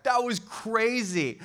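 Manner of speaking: high, drawn-out amazement; awed excitement. Acted. Disciples back from the mountain, thrilled amazement. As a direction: stretch it out, thrilled by what you saw.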